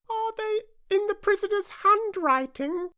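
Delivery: very high squeaky juror; squeaky juryman. Acted. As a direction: ask in a tiny high voice. Juryman asks.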